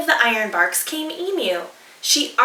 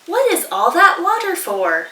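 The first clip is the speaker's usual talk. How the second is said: a higher, nosy platypus voice; higher, curious platypus; 6 semitones higher, 3 dB louder than usual. Acted. Platypus asking dingo about the water, higher and curious. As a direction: peer into the pot and ask.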